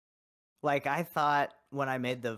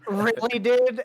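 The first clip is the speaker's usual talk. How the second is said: exasperated; 6 semitones higher, 6 dB louder, slower than usual. Retold or imitated. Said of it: "And I'd be like".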